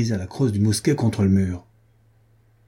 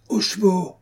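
high-pitched rallying call; cried command; 9 semitones higher than usual. A narrator's reading. Jean de Werth ordering soldiers to horse, crying out. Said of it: "cria-t-il".

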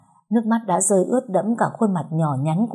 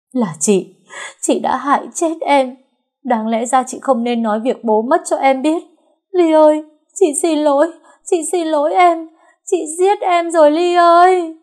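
high, loud wailing, building in force; anguished self-blame; 10 semitones higher, 6 dB louder than usual. A narrator's reading. Sister blaming herself over the body, anguished wail. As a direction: cry out in guilt, drawing out the last words. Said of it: "miệng lẩm bẩm".

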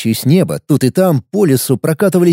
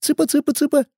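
high coaxing chick call; 13 semitones higher, 5 dB quieter, faster than usual. Acted. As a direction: call it like feeding hens. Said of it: "Опустился даже до".

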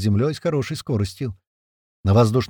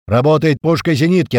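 loud, high command; shouting; 5 semitones higher, 6 dB louder than usual. A narrator's reading. Commander assigning the guns amid firing, shouting. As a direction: shout the order over gunfire. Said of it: "прокричал Туман между выстрелами".

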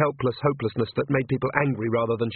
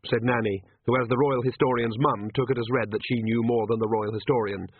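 ordinary delivery

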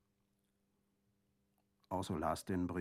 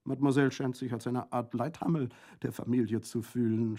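higher, louder teasing remark; joking; 6 semitones higher, 8 dB louder than usual. A narrator's reading. Prefect mocks Colomba before Orso, joking. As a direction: tease her lightly. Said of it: "scherzte der Präfekt".

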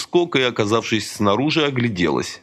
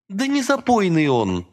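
helpless; 10 semitones higher than usual. A narrator's reading. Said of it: "беспомощно сказала Лика".

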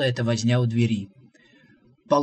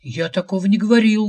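higher, louder outraged denial; indignant; 6 semitones higher, 7 dB louder than usual. A narrator's reading. Visitor outraged at prophet's claim, indignant. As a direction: protest hotly. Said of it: "возмутился Шамбамбукли".

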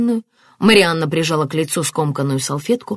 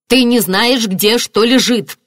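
higher, louder angry outburst; boiling over; 6 semitones higher, 6 dB louder, slower than usual. A narrator's reading. Marianna to Tanver over the tea, boiling over. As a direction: flare up in irritation. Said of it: "вскипела Марианна".

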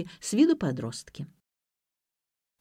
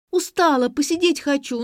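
whining; 8 semitones higher, 5 dB louder than usual. A narrator's reading. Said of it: "заныла".

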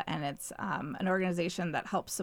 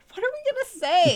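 panicked quote; 13 semitones higher, 6 dB louder, faster than usual. Retold or imitated. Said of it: "And we're like".

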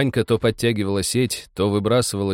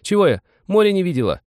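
put-on high, louder girl's cry; defiant shout; 11 semitones higher, 5 dB louder, faster than usual. A narrator's reading.

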